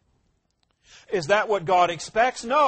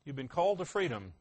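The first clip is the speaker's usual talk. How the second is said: "You've been called to freedom" said much softer and quicker; quiet echo; 3 semitones lower, 8 dB quieter, faster than usual. Shown as a demonstration. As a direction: drop to a soft, fast personal aside. Reads verse, then echoes it.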